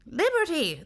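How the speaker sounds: very high, thin voice correcting one word. A narrator's reading. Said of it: "the thin voice corrected".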